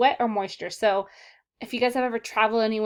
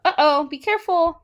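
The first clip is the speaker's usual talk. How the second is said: mock alarm; 7 semitones higher, 8 dB louder than usual. Mock warning to the class.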